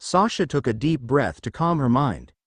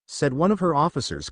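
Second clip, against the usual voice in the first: ordinary delivery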